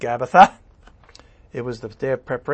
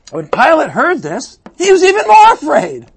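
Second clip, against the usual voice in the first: dramatic reading; 12 semitones higher, 10 dB louder than usual. Acted. Dramatic Bible reading climax.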